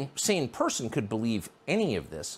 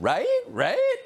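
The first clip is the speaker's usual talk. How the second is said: high rising squeaky "Right? Right?" with upturned end; mock a sing-songy activist, rising "Right?"; sing-songy woman; 5 dB louder than usual. Host mocking a cable-news activist, sing-songy rising voice. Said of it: "sing-songy voice, inflection at the end".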